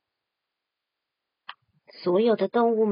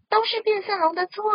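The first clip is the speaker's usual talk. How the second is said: 高而响的群体怒喊; 一群动物一起冲着河马喊; 齐声吼叫; 10 semitones higher than usual. Acted. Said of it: "都生气地大声吼叫". (大声怒吼)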